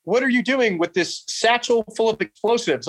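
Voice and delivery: lightly voiced officer demanding an explanation; imitated officer's challenge. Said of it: "they're like".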